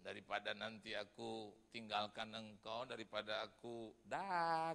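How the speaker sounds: low, slow, soft divine voice; God speaking softly to the preacher. Retold or imitated. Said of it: "Tuhan bilang begini".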